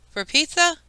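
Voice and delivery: high, startled disbelief; shocked. A narrator's reading. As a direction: caught off guard by the price. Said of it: "Kate looked shocked".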